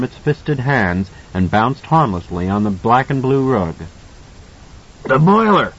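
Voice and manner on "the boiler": higher, louder terrified monster cry; cried out. A narrator's reading. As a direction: panic in the creature voice. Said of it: "it cried".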